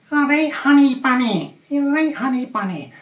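high, loud doting coo; Brian's pet-name voice. Retold or imitated. Man cooing a pet name to his wife. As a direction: sweet-talk the wife like Brian. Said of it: "he calls her".

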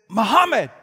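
high, loud crowd-stopping shout; screaming out. Retold or imitated. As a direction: holler the name as loud as you can. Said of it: "As loud as he could".

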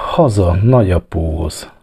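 ordinary delivery

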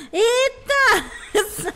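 high, loud startled yelp into a laugh. Real, not acted. Streamer startled by a monkey's scream, yelping then laughing. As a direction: jump at the scream and burst out laughing.

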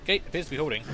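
normal talking voice

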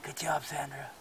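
hushed whisper at night. Real, not acted. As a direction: whisper so the owl isn't scared. Whispering so the owl stays.

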